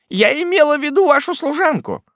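high, testy correction; irritated aunt. A narrator's reading. Miss Betsey correcting the widow, testy. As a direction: set her straight crossly.

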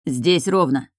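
usual speaking voice

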